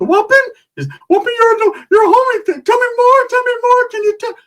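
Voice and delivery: very high, loud cartoonish squeal; excitable Ricky impression. Ricky begging the homie for war stories, hyped-up high squeal. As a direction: pester in a squeaky, hyped-up little voice.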